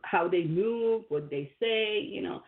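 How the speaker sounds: ordinary delivery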